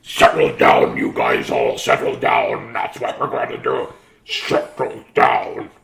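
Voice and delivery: low, loud, slow rasp; gruff teacher voice. Acted. Trying another voice for Miss Nelson, gruff. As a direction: growl at the class to settle. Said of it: "Miss Nelson said in a sweet voice".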